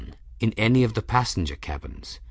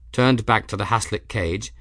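normal talking voice

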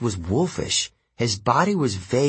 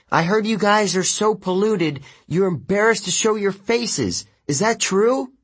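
taunting; 11 semitones higher than usual. Acted. Taunting the river gods.

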